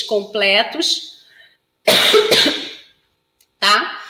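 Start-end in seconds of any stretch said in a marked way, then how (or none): 1.9-2.7 sudden dry cough. Real, not acted. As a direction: cough sharply mid-sentence. Coughing mid advice.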